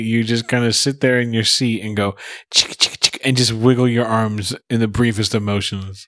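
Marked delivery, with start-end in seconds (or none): 2.5-3.2 imitating a whispered chick chick chick; a quick unvoiced chick chick chick; mock charge sound. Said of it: "wish the people on the podcast could see it".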